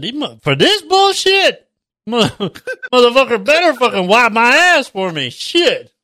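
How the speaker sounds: high-pitched, indignant comic rant; retold outrage. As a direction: act out sticker shock, breaking into a laugh.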